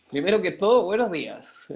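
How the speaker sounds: bright upbeat hello to the class. Shown as a demonstration. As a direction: pump up the energy on the greeting. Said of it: "Póngase algo más animado".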